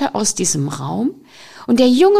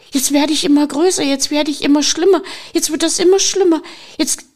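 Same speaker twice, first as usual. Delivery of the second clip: Fear spiralling, words tumbling out faster and faster; mounting panic; 5 semitones higher, faster than usual. Acted. Fear trapped in the room, talking herself into panic.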